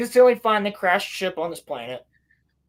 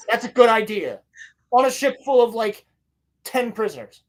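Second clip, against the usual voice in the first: animated, higher mock rant; sarcastic scolding; 4 semitones higher, 3 dB louder than usual. Reviewer voices the others scolding a Jedi, sarcastic rant. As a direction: pile up the absurd details in exasperation.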